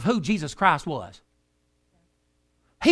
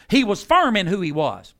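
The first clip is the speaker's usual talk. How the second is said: ordinary delivery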